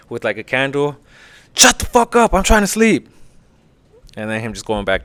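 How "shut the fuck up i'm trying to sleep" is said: high-pitched mock scolding; woken-up prisoner scolding, imitated; imitated angry Caesar. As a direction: snap at the noisy crew in a raised voice. Caesar scolds the rowdy pirates.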